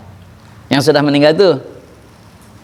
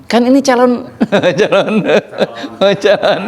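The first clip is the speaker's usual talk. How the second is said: laughing through words; 5 semitones higher than usual. Real, not acted. Laughing while telling dream.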